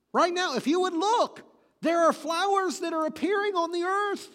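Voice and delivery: high, loud preaching; impassioned proclamation. Real, not acted. Preacher urging listeners to look at flowers, loudly exhorting. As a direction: build to a ringing, high-pitched declaration.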